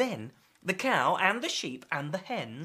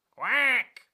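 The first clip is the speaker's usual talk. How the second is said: higher, louder quack; raised-pitch duck reply; duck answering; 5 semitones higher, 6 dB louder than usual. Acted. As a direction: quack back loudly.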